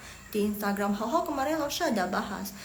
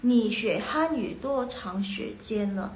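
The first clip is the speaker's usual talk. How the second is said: slow, careful reading of the Chinese sentence; slow Mandarin demonstration; slow model sentence; much slower than usual. Shown as a demonstration. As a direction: read it out slowly for learners to follow. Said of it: "Ini dua kata ini".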